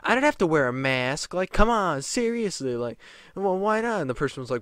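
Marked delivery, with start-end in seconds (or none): 0.0-1.3 mocking entitled whine; whiny Karen. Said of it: "And she goes". 1.3-4.0 mocking entitled complaint; whiny Karen. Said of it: "And she goes".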